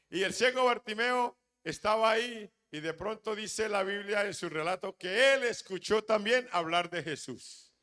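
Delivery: predicador enérgico, voz alta y aguda; prédica a plena fuerza. Acted. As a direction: proclama el relato con fuerza. (predicando con fervor, en voz alta)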